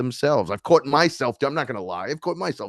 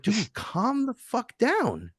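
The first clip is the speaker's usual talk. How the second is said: exasperated; 14 semitones higher, 4 dB quieter, much slower than usual. Retold or imitated. Telling off impatient person.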